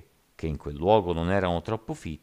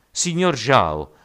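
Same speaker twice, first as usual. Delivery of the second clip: loud, high, stretched-out call; 8 semitones higher, 9 dB louder, much slower than usual. A narrator's reading. Giant man calling up to Zhao, shouting. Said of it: "gridò un uomo di statura quasi gigantesca".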